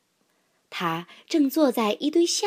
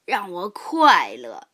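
put-on old rabbit grandpa. A narrator's reading. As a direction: savour each word like a kindly elder.

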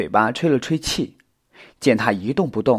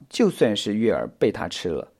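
plain reading voice

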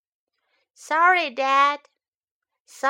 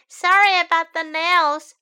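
put-on child's sorry; 6 semitones higher, 8 dB louder than usual. Child apologizes to dad.

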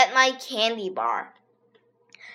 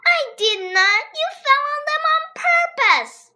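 high, loud indignant denial; angry girl; 3 dB louder than usual. A narrator's reading. Andrea denies pushing AJ, high and angry. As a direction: snap back, very high.

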